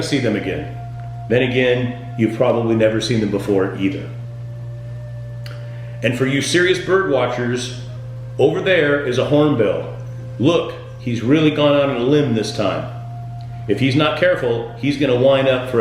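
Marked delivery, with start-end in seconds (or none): none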